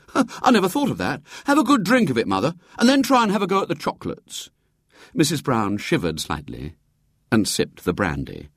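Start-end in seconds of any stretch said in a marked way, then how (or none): 0.1-4.5 put-on high, rapid, cheery boy; cheerful. A narrator's reading. William urging brandy on his queasy mother, cheerfully. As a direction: rattle it off cheerfully, pitched up. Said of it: "said William cheerfully".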